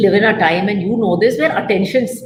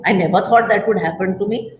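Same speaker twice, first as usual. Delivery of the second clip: ordinary delivery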